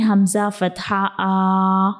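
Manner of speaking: stretching the vowel way too long; आ held far too long as a wrong example; drawn out too long. Shown as a demonstration. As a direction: drag out the आ long and slow. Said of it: "ज़्यादा लंबा करके पढ़ना है".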